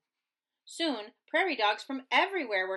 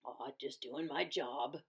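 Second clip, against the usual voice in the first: lower, soft, bashful reply; modest smile; 7 semitones lower, 9 dB quieter than usual. A narrator's reading. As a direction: shrug it off humbly. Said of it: "Big Bark smiled".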